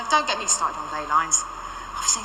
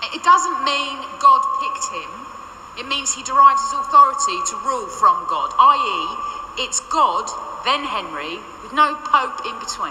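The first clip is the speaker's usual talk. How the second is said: pedantic correction; 7 semitones higher, 6 dB louder than usual. Acted. Correcting the Tudor figures in a loud pedantic lecture. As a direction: set the record straight, exasperated.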